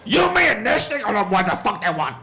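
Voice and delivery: put-on high, loud American-accented outburst; outraged American accent. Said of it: "I've been doing this accent".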